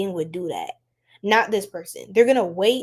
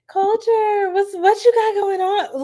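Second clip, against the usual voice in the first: excited greeting; 10 semitones higher, 4 dB louder, slower than usual. Real, not acted. Greeting a live chatter.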